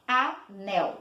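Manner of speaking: "Anel" split in two, A, NEL; two distinct beats in "Anel"; syllable by syllable. Shown as a demonstration. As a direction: say "Anel" with a gap between syllables. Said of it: "Duas vezes".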